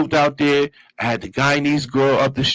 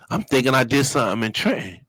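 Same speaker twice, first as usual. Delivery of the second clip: ordinary talk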